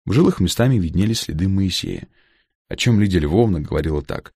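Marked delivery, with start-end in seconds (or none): none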